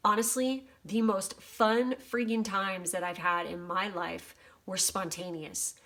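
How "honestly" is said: ordinary talk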